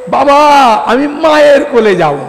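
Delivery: put-on high, loud little boy's plea; a small child begging to go to mother; pleading child. Kanai on father's lap begs to go to his mother, high pleading child voice.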